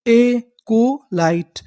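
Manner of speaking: एकोलाइट said slowly, syllable by syllable; very slow sounding out. Shown as a demonstration. Teacher sounding out the word for the class, very slow. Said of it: "तो पूरी meaning क्या बनी?".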